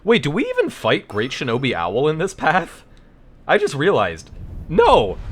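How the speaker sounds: high, loud sudden realization; shocked alarm rising to a yell; surprised. Real, not acted.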